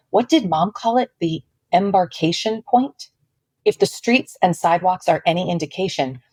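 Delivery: usual speaking voice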